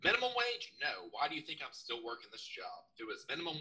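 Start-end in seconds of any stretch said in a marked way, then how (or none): 0.0-0.7 high, loud disbelieving echo; incredulous. Real, not acted. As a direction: scoff at the very idea.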